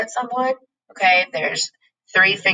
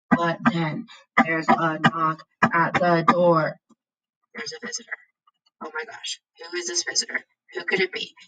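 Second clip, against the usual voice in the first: hushed suspense. Acted. Teacher teasing students about the mystery visitor, hushed suspense. Storyteller building cliffhanger suspense.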